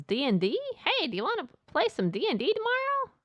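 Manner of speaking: high, swooping mock invitation; Michelle. Inviting someone to play D and D, high put-on voice. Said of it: "Someone named Michelle says".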